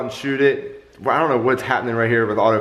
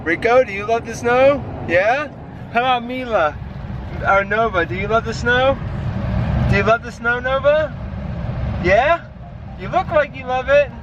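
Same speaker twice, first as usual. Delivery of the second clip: pet talk; 8 semitones higher than usual. Real, not acted. Talking to his dogs in snow.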